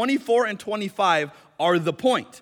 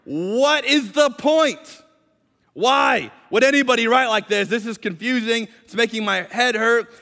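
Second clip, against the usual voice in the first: higher, louder, drawn-out exasperation; frustrated listener; 4 semitones higher, 5 dB louder than usual. Pretend. A fed-up congregant complaining the passage is confusing, exasperated. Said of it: "You might be going".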